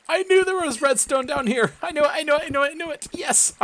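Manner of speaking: high excited squeal. Real, not acted. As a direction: squeal with glee at the find. Finds redstone, squeals in triumph.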